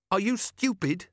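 put-on high, scornful teenage jab; rude boy voice. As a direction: sneer it like a cheeky lad. Boy snaps at Carrot.

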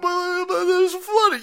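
very high, slow, loud put-on whine; mock panicky believer. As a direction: stammer it out like a frightened believer. Mocking climate believer.

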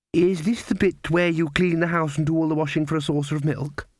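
put-on an old woman hoping for a chore; hopeful grandmother. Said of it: "said the grandmother hopefully".

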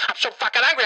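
anger breaking out, pitch jumping up; angry outburst. Acted. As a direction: let the held-in fury burst out.

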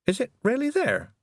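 put-on high, wondering young girl. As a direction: ask it in awe. Tiffany voice.

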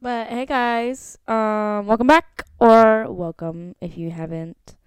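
podcast host welcome. Acted.

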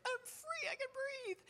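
tiny, very high, soft squeal; hushed high-pitched voice of joyful relief; imitated joy. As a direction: squeak it out softly, overjoyed. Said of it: "this picture of joy".

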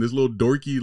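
ordinary talk

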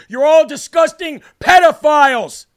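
slow, loud, furious yell; furious shout. Real, not acted. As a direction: shout it out in rage. Ranting at the show's hosts.

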